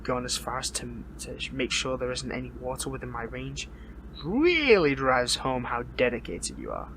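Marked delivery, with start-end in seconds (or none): 4.2-6.9 drawn-out, loud mocking emphasis on the start; sarcastic admiration. Captive hero mocking the villain's precautions, sarcastic.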